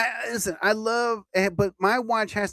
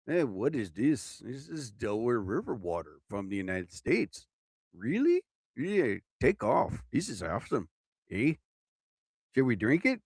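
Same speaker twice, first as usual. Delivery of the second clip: put-on Canadian accent; 4 semitones lower, 4 dB quieter than usual. Puzzled Canadians getting bottled river water, in a Canadian accent. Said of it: "I don't know if that's my best Canadian accent ever".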